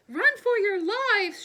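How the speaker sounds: very high, very loud slow shriek; panicked cousin screaming. A narrator's reading. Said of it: "shrieked Cousin Elwood".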